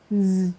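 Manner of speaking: soft sustained buzz on "Z"; isolated Z sound. Shown as a demonstration. Teacher answering which sound is in design, buzzed Z. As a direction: say "Z" as a held zzz. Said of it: "Tiếp tục đó là âm".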